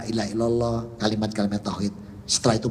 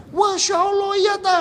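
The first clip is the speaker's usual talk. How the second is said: much higher, astonished; amazed exclamation; 4 dB louder than usual. Retold or imitated. Family hearing that he said the creed, amazed. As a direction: gasp at the good news.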